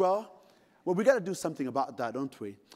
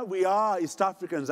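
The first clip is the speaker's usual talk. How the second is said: ordinary talk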